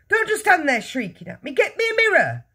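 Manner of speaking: high yell, climbing sharply at the end. A narrator's reading. Father orders his shrieking wife about, yelling. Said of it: "the father yelled".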